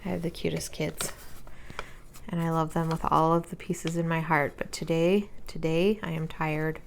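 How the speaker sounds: soft, worn-out voice; tired. Real, not acted. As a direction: sound drained at day's end. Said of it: "I'm a tired mama".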